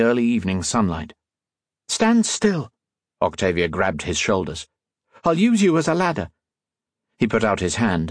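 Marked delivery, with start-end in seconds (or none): 1.9-2.7 high, brisk character order; high-voiced instruction; commanding heroine. A narrator's reading. Octavia gives Modo an order. 5.2-6.3 high-pitched character voice; lightly voiced high heroine; decisive heroine. A narrator's reading. Octavia instructs Modo while climbing.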